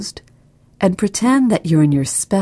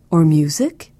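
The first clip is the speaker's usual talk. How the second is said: plain reading voice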